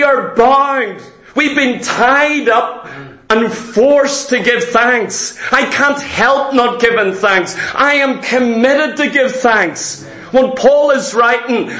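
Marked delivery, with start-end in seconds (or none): none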